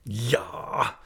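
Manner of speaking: scornful snarled exclamation; narrated aunt's snarl. A narrator's reading. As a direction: snarl it at him in one burst. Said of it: "with such a snarl at him".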